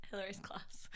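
breathy admiring whisper. Real, not acted. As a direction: breathe the words in awe. Quoting the description admiringly.

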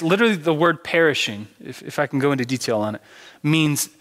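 ordinary delivery

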